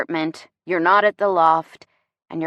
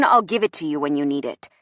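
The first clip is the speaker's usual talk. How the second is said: ordinary delivery, nothing marked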